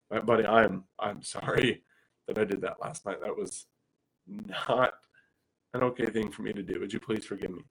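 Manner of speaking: put-on soft, low, gentle apology to a small child; a dad quietly apologizing to his toddler; tender apology. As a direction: say sorry softly to your little boy. Apologizing to his two-year-old.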